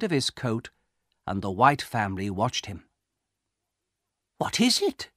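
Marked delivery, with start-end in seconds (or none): 4.4-5.1 very high, curious woman's voice; anxious cry. A narrator's reading. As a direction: ask high, without raising the volume.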